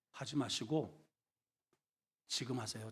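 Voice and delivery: ordinary talk, nothing marked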